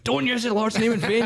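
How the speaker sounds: high mock prim scold; mock scolding teacher. Deputy head scolding the pupil, high mock prim voice. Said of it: "And she went".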